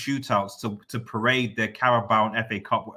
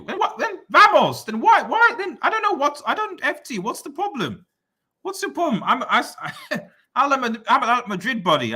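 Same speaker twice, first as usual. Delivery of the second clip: much higher, laughing disbelief; incredulous laugh; 11 semitones higher than usual. Real, not acted. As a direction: squeal out the shock through laughter. Reacting to "my club is Madrid.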